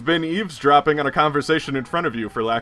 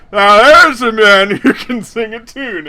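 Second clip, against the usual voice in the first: put-on loud, slow, higher drunk bellow; drunk greeting; 9 semitones higher, 11 dB louder, slower than usual. Drunk Chad hailing a new stranger while dragged away, loud. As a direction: hail a stranger like a happy drunk. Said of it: "and he goes".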